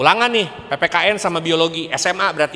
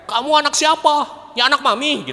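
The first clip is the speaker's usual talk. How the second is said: much higher mock nagging; nagging parent and cheeky reply; 10 semitones higher than usual. Parent nagging, child talks back, mock high voices. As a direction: squeak out a nagging exchange. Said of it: "Tapi jangan merepet".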